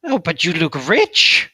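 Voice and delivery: loud, slow greedy little creature. Acted. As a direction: drool over their riches like a goblin. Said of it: "He says".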